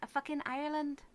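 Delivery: high, hammed-up Irish accent. Shown as a demonstration. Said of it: "struggling to do my own Irish accent".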